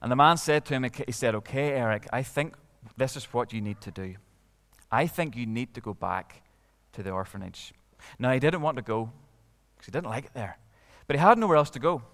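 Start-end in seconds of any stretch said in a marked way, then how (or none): 1.4-7.7 put-on lower, soft old man voice; kind old man. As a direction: gently advise like a granda. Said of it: "a bit of an older man".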